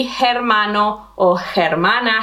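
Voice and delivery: "hermano or hermana" with an audible English H; "hermano or hermana" mispronounced as HER-mano, HER-mana; wrongly sounded H. Shown as a demonstration. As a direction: breathe out a clear H starting "hermano" and "hermana". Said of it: "it's not going to be".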